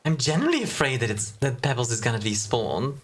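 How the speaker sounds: ordinary talk, nothing marked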